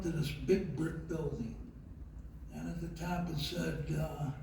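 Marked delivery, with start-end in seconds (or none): none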